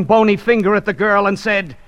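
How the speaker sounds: usual speaking voice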